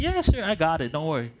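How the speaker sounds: a hint of overconfident young me; cocky reply. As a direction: toss it off like it's nothing. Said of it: "And I was like".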